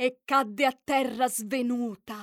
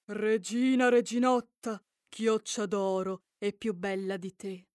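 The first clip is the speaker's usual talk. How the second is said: fountain's voice; 5 semitones lower than usual. Acted. Magic fountain answers the queen.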